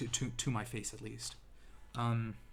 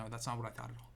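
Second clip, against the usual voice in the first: ordinary talk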